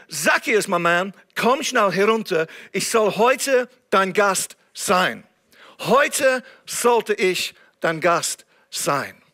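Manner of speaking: ordinary talk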